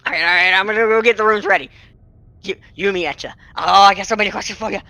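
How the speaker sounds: flustered fangirl, racing and stumbling over words; Lil. Acted. Young innkeeper's helper offers her idol meat, flustered and fangirling. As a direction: gush in hurried bursts, pitch climbing as you fumble. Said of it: "total fangirling".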